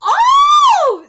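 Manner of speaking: high, loud drawn-out "oh"; loud wailing cry. Real, not acted. Reader hit by the sad scene, loud wail. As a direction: wail out high at full volume.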